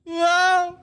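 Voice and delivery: high, loud crooning whoa; singer's whoa. Retold or imitated. Imitating [name] teasing the concert crowd, high showy whoa. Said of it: "he goes".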